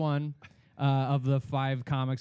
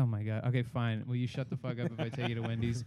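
exasperated; 6 semitones lower, 5 dB quieter, faster than usual. Retold or imitated. Exasperated with drunk friend.

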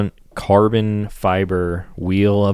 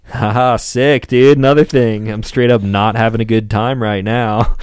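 sarcastic good spirits; 3 semitones higher, 5 dB louder than usual. Retold or imitated. Downhearted rider joking about one more blow, sarcastically upbeat. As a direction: laugh it off with forced enthusiasm. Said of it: "even if it's sarcastically in good spirits".

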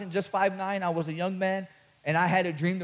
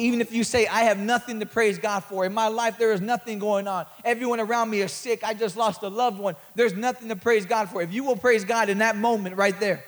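preaching at full force; 4 semitones higher, 7 dB louder than usual. Acted. Preaching climax.

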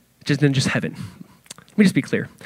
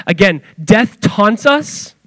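usual speaking voice

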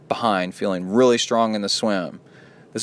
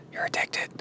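whisper; 9 dB quieter than usual. Real, not acted. Aside while reading listener email.

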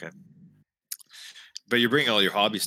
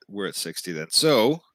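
ordinary delivery

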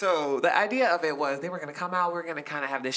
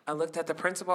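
normal talking voice

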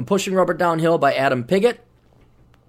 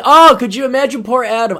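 normal talking voice

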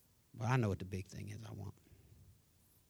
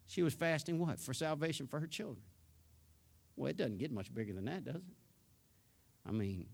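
ordinary talk

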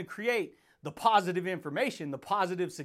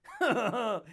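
big laugh; 2 semitones higher than usual. Real, not acted. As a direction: burst out laughing. Laughs before catchphrase.

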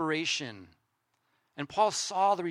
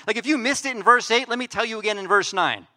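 normal talking voice

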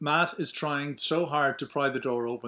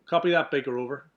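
over a radio; 2 semitones higher, 8 dB louder, faster than usual. Acted.

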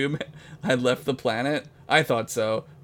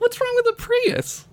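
mock offended.

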